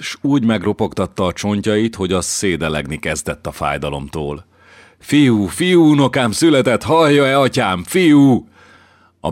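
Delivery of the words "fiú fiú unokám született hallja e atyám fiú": high, loud jubilant cries; overjoyed. Acted. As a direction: shout it to the priest, beside yourself. Grandfather overjoyed at grandson's birth.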